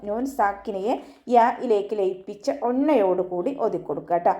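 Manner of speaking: ordinary talk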